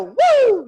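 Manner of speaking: high, loud swooping woo; bright falsetto whoop, falling at the end; head voice "woo". Shown as a demonstration. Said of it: "That's your head voice".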